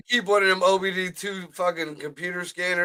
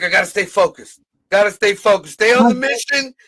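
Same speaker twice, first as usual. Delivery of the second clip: raised, driving self-coaching; mock pep talk; 4 semitones higher, 6 dB louder than usual. Man coaching himself to stay focused, raised mock urgency. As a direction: push it out loud like coaching yourself.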